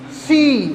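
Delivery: सी with its vowel held, "seee"; long stressed ee. Shown as a demonstration. Teacher reading the written example, सी drawn out long. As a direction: stretch the ee in सी and let it fall. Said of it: "stress दे के बोलना है".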